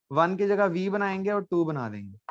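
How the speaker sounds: usual speaking voice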